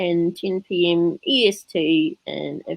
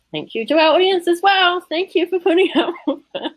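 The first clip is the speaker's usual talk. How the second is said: cheerful sign-off; 12 semitones higher, 4 dB louder than usual. Real, not acted.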